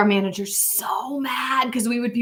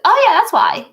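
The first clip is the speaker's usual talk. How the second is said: much higher and louder mock aha; sudden realization; 12 semitones higher, 6 dB louder than usual. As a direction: imitate someone finally getting it. Client suddenly realizes later.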